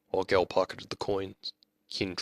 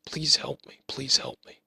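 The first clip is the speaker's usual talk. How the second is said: pleading whisper; 6 semitones higher than usual. Retold or imitated. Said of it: "just say".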